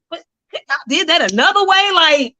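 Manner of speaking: high, loud, incredulous complaint; indignant protest. Retold or imitated. Protesting to God about being broken, high indignant pleading. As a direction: demand an answer, outraged.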